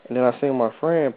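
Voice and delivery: ordinary talk, nothing marked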